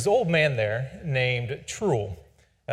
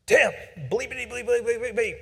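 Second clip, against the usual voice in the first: much higher bleeped-out swearing; sing-song censor bleeps standing in for curses; a cranky instructor swearing at his tired student, bleeped mock cursing; 10 semitones higher than usual.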